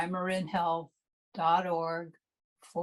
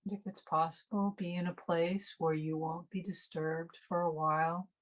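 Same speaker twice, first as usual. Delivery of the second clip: guided meditation. Real, not acted. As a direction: ease listeners unhurriedly into resting and noticing the breath.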